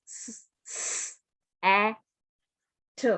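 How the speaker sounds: "s" hissed without voice, then "a", "t"; sounding out letters. Shown as a demonstration. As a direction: sound out each letter slowly. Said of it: "you have learned three sounds".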